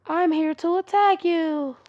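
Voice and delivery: disguised intruder voice. Acted. Hinata disguising herself to attack Naruto, loud put-on voice. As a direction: announce it slowly and loudly in a disguised voice. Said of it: "her voice just deeper, just, like, louder".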